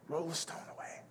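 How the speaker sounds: whispered, barely voiced repeat; hushed command. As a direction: whisper it to the congregation.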